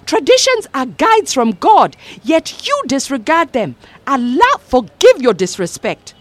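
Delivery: high, lecturing mother; a pious mother scolding, rising to a shout; stern Mama. A narrator's reading.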